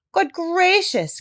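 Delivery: mock startled exclamation; a surprised old gentleman crying out. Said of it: "cried the doctor".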